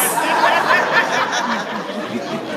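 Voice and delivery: long loud laughter; hearty laugh. Real, not acted. Mother laughing at her son's friends' praise, loud hearty laugh. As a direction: burst out laughing. Big laugh after story.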